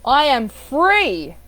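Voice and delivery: "free" loud, stretched and falling; stress on FREE. Shown as a demonstration. Coach demonstrating inflection, stress on "free". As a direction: build to a long, ringing "free". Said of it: "Different inflection".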